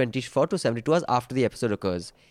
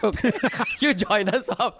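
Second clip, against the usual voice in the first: laughing invite; 8 semitones higher than usual. Real, not acted. Joking invitation to the listener.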